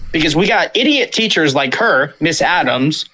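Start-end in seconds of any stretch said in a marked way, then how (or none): none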